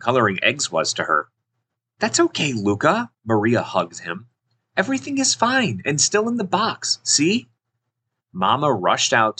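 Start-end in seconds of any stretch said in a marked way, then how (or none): 2.0-3.1 put-on high, soothing child character; sweetly reassuring, pitched up; reassuring big sister. Sister comforts crying little brother. 4.8-7.5 put-on high-pitched, cheery child voice; bright-pitched "all is well" reassurance; reassuring big sister. Sister reassures little brother.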